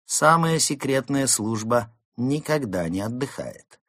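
composed pronouncement. Acted. Fox to Mole on her duty, composed. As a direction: state it proudly without shouting.

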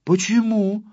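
high, anxious demand; alarmed question. A narrator's reading. Pyostrenky wants to know why it is worse, alarmed. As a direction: ask high, with a touch of fright. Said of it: "испугался Пёстренький".

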